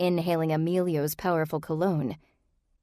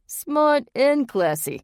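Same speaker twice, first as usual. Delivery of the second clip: high, slow, drawn-out Jersey flattery; slow Jersey charmer; Jersey accent; 9 semitones higher, 5 dB louder, much slower than usual. A narrator's reading. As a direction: croon the compliment in a Jersey accent. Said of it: "in his thick Jersey accent".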